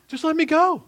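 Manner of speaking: high, loud begging to be let go; high, loud cry to just be released; desperate pleading. Pretend. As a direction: beg like you're trapped in a sales pitch. Pretend pleading to escape timeshare pitch.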